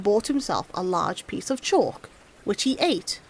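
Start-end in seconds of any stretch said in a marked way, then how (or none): none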